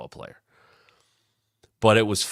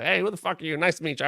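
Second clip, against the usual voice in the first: gruff brush-off; 9 semitones higher, faster than usual. Retold or imitated. Angry Earl greets the newcomer.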